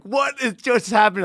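high-pitched, thrilled outburst; giddy disbelief. Retold or imitated. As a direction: retell it as if jumping up and down. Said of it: "jumping up and down".

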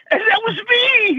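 choked up as the memory hits; crying. Real, not acted. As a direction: voice breaking into tears, pitched high. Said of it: "I'm sorry I'm crying".

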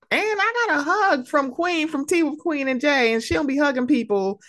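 high-pitched, excited fangirl gushing. Real, not acted. Fan telling listeners about a hug from Queen, excited gushing.

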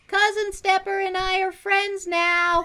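high, bright happy call; cheerful shout. Acted. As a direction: shout it out joyfully. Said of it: "shouts to the others".

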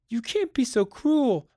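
high-pitched reproach; pleading protest. A narrator's reading. Begging him not to switch it off, pleading protest.

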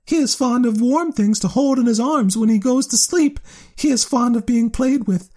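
high piping bat chatter. A narrator's reading. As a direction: pipe it up high like a tiny bat. Bat character voice.

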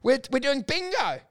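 high, silly mock voice; mocking the organisers. Mimicking festival staff to his co-host, silly high mock. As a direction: ham up the giddy organisers. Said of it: "they're going".

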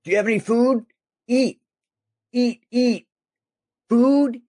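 very high, loud squeaky mimicry; high-pitched mock begging in short bursts; pestering little character. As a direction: pester for food in a tiny high voice.

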